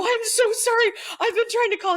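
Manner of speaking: put-on apologizing to the grounded kid; squeaky apology. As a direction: gush an apology in a high squeak.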